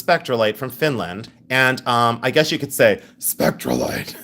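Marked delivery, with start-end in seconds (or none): 3.2-4.2 half-successful Cookie Monster-like voice. Pretend. Seller trying out a stone's name, put-on monster voice. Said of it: "It doesn't work with the monster voice".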